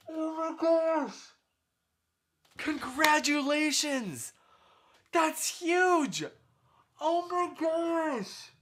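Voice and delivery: high, loud, drawn-out cries of joy; shower them with thrilled congratulations; overjoyed gushing. Pretend. Rehearsing a big congratulation.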